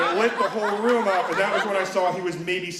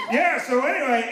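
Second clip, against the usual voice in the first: loud, raised-pitch oblivious chatter; getting louder; 8 semitones higher, 5 dB louder than usual. Retold or imitated. Moviegoer chatting over explosions, imitated loud talking. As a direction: talk right over the movie, mock loud. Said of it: "He's getting louder".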